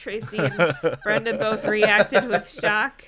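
loud, slow, through real laughter; real laughter stretching the words; laughing narration. Real, not acted. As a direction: struggle through the line cracking up.

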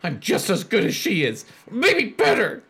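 high, bitter jealous rant; raging. Acted. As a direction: spit out the jealousy. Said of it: "In a rage".

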